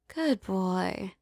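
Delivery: normal talking voice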